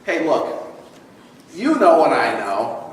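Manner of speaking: ordinary delivery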